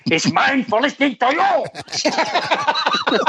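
sudden high, drawn-out mock scream; imitated outburst. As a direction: explode into a slow, high-pitched yell of blame. Seve's comfort flips to blame.

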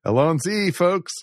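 bright, high excited Allons-y; lightly channel the tenth Doctor's sign-off; a hint of the Doctor's gleeful cry; tenth Doctor catchphrase. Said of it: "as the tenth Doctor likes to go".

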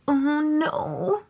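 high-pitched, drawn-out dismay. Retold or imitated. Guest seeing the dirty, dusty room, high dismayed reaction. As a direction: act out a sinking little high wail.

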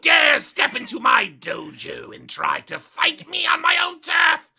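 loud, high, outraged challenge; dojo master shout. Acted. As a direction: bellow like an offended sensei. Skeleton dojo master challenges imitator.

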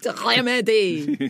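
exaggerated German-accented delivery; comic throaty-R German accent. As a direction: overdo the German accent for laughs. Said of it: "go back to my German accent".

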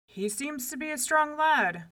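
a touch of worry in a big voice; a little worried. A narrator's reading. Giant watching the baby eat, slightly worried. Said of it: "getting a little worried".

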